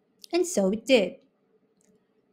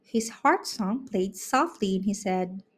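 ordinary delivery

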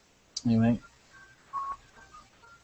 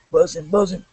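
ordinary talk

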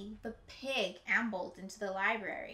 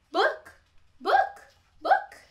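each cluck higher and louder, much higher; clear clucking; 13 semitones higher, 9 dB louder, much slower than usual. Acted. Hen makes herself clear, clucking ever higher and louder. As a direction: cluck rising to a loud high squawk. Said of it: "clucked the hen quite clearly".

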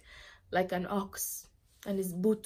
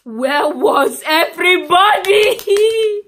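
drawn-out scream, climbing high; anguished scream; 10 semitones higher, 20 dB louder, slower than usual. Acted. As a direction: scream it out slowly, pitch rising to the end. Survivor screams at absent neighbours.

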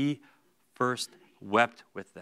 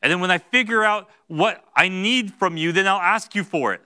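louder, higher, insistent pushback; stubborn self-reliance; 5 semitones higher, 7 dB louder than usual. Retold or imitated. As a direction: brush God off, determined to handle it alone. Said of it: "you're telling God".

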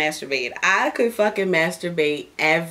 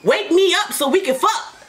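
higher, whiny jealous demand; mock jealous complaint; 8 semitones higher than usual. Wife whining at Daddy for masturbating without her. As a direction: play the left-out girlfriend. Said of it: "I be like".

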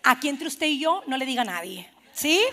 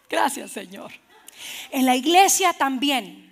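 usual speaking voice

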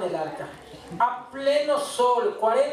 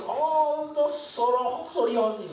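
mock Japanese-accented English, a bit higher; 5 semitones higher, much slower than usual. Preacher mimicking the Japanese clerk's apology in Japanese accent. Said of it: "con su acento japonés".

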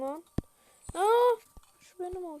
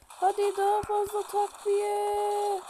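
slow, drawn-out yell; calling for reinforcements; 3 semitones higher, slower than usual. Real, not acted. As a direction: call the order across the field. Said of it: "Ben ne çok bağırıyorum lan". (uzatarak bağırma)

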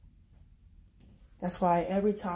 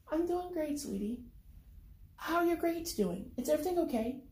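higher, gentle motherly voice; sweet mom voice; 9 semitones higher than usual. Acted. Mom asking her son about grades, sweet and higher. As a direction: soothe him like a caring mother. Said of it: "Her sweet voice always calms me down".

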